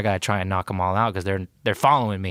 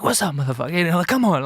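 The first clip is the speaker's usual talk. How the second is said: mock street taunt, pitching higher; retold taunting; 7 semitones higher, faster than usual. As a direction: goad them to come at you. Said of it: "talking hella shit".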